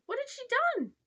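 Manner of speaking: very high, loud amazed question; high-pitched boyish astonishment; astonished. Acted. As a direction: squeal it like a thrilled, shocked boy. Boy astonished at news of prison.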